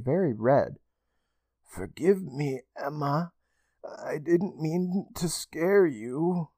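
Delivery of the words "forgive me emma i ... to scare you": soft, slow, eerie whisper; haunting, hollow and slow. Acted. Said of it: "with a strange, haunting voice".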